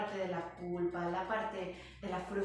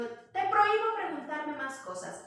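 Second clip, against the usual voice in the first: annoyed mother; 7 semitones higher, 8 dB louder than usual. Retold or imitated.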